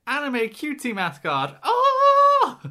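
high and loud, building to a squeal; squealing mascot cry. Pretend. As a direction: shriek it like a cute mascot. Imitating the mascot pop-up.

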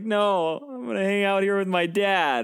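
a hint of childlike contentment; acted happy son.

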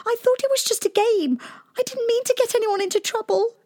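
high, anxious plea, rushing at the end; sorry princess pleading her case. A narrator's reading. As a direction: beg for forgiveness.

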